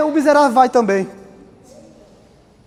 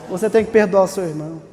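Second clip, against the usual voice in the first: ordinary talk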